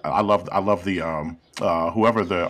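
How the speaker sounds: ordinary delivery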